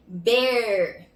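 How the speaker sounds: "ber" with a long growled R; drawn-out final R. Shown as a demonstration. Teacher giving the second syllable, drawn-out R. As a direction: hold the R at the end of "ber". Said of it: "E a segunda sílaba é".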